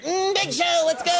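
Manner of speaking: high squeaky sidekick voice; puppet voice. Retold or imitated. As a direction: pitch way up like a little puppet. Imitating Norbert puppet voice.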